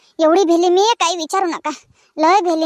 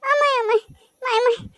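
high, panicked calls for mother; startled by a ghost, cry out; scared cry; 5 semitones higher than usual. Acted. Frightened at seeing ghost. (घाबरलेली हाक)

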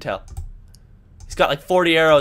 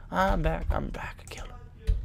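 hushed, breathy taunt; whispering; 13 dB quieter than usual. Real, not acted. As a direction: whisper it like a threat. Whispered taunt after loud ones.

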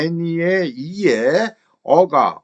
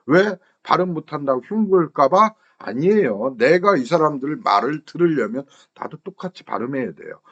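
ordinary delivery

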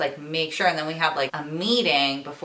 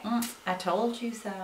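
softer, higher knowing murmur; Southern grandma; 4 semitones higher, 8 dB quieter than usual. Acted. Imitating a grandma who told you so, softly smug. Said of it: "Like your Southern grandma".

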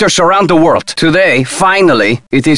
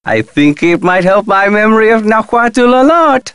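coy hinting; 5 semitones higher than usual. Acted. Asking for the file in exchange for help, coy hinting. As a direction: pitch climbing steadily into a suggestive hint. Bargaining a favour for a translation.

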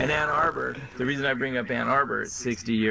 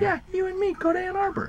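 squeaky high voice. Pretend. Silly squeaky voice bit.

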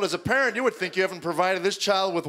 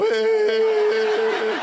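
much higher, louder squeaking yelp; voice crack; 5 dB louder than usual. Retold or imitated. Teen son calls to dad, voice cracking. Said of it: "He had that crackling thing".

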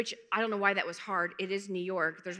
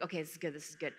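soft, low, private self-talk; hushed relief; 6 semitones lower, 12 dB quieter, faster than usual. Retold or imitated. Telling herself the dark, empty church is good news, hushed. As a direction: keep it quiet, excitement held in. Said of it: "I'm so excited".

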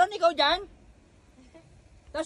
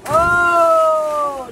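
long, loud, higher "oh"; loud whoop; 4 semitones higher, 8 dB louder than usual. Real, not acted. Watching a friend jump, loud drawn-out whoop.

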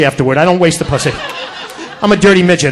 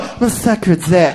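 mocking lisp; 5 semitones lower, 5 dB quieter than usual. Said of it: "It's a lisp".

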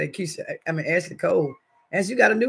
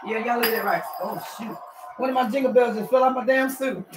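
ordinary talk

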